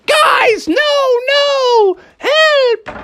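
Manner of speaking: very high wailing shriek; desperate cry for help. Acted. Cobra Commander hit by magic, desperate screaming. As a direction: scream in hammed-up terror.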